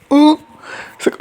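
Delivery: loud, very high gasped oh; mock shock. Woman reacting to the kiss, mock shocked. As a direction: yelp in exaggerated surprise. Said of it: "I'm so shocked".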